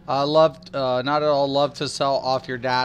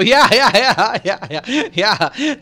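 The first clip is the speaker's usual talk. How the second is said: loud, high rapid-fire yeahs; excited agreement; 7 semitones higher, 9 dB louder, slower than usual. Real, not acted. Streamer agreeing with a viewer about a scenario, excited. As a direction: burst of real laughing excitement.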